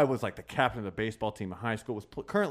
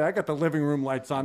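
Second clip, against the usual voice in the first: usual speaking voice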